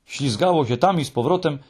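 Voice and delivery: plain reading voice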